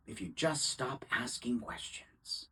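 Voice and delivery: soft, near-whispered insinuation; hushed aside. Acted. Defense lawyer warning the jury to keep questioning, hushed near-whisper. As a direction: drop to a whisper for dramatic effect. Lawyer hushing for dramatic effect.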